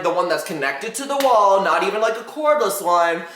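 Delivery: usual speaking voice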